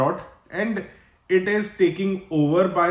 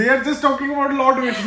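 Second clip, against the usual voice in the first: laughing protest; 9 semitones higher, 5 dB louder, faster than usual. Real, not acted. Laughing protest about Vishnu jokes.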